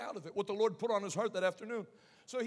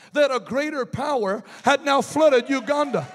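shouted preaching; 3 semitones higher, 22 dB louder than usual. Acted. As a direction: preach at full force, building.

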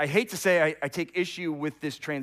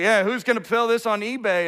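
raised, loud soldier's scoffing; mockery; 7 semitones higher, 7 dB louder than usual. Roman soldiers casting lots for his clothes, acted mockery. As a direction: lightly act a heckler treating it as a joke. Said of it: "they're making a mockery of him".

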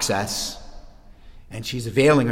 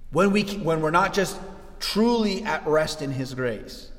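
usual speaking voice

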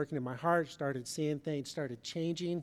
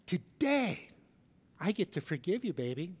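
mock eager delight. Spouse greeting the marriage each day, hammed-up eager delight. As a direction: burst in like it's a thrilling surprise.

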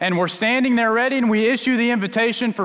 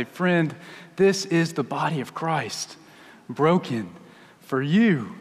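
low, slow solemn tenderness; tender; 4 semitones lower, slower than usual. Retold or imitated. Pastor leaning over to give a child communion, tenderly. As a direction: offer it gently, pausing between phrases. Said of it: "as tenderly as I can say it".

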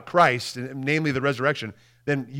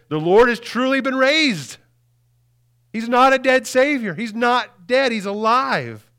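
higher and louder, bursting with the news; joyful proclamation; 9 semitones higher, 4 dB louder than usual. Acted. Disciples telling the eleven, excited and loud. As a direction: announce it like the best news ever.

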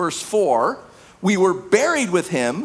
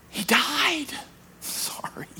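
hushed brush-off; 5 semitones higher, 8 dB quieter, slower than usual. Acted. Acted reply to sin, quiet.

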